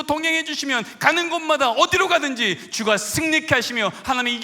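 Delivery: loud, driving sermon cadence; full-force preaching. Acted. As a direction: preach it out, building to each 믿습니다.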